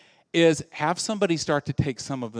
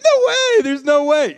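much higher and louder no way; a hammed-up parent's high yelp of refusal; mock horrified shriek; 12 semitones higher, 8 dB louder than usual. Parents refusing before having kids.

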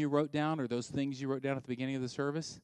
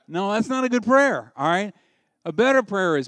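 loud, high correction at full force; emphatic preaching; 8 semitones higher, 12 dB louder than usual. Real, not acted. Pastor corrects a couple's selfish prayer, loud emphatic. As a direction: push it hard, correcting them.

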